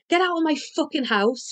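high, angry yell. A narrator's reading. Tony kicking the narrator out, angry yell. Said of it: "she yelled at me".

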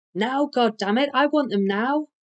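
high, loud, insistent order; angry demand. A narrator's reading. Waiter overriding the cook's protest, angry demand.

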